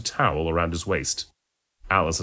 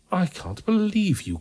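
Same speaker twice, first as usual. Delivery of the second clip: high, drawn-out groan of disbelief; pained, exasperated disbelief; 13 semitones higher, slower than usual. A narrator's reading. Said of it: "groaned the doctor".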